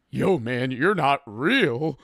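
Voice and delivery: slow, eerie, spaced-out voice; tripping friend. Retold or imitated. Tripping friend telling the streamer he is not real, slow and eerie. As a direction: say it like someone lost in a bad trip. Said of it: "I hear".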